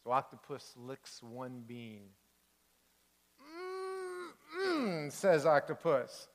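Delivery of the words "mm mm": high, drawn-out fake mm; mock yummy. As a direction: pretend the bean tastes great. Octopus fakes liking beans.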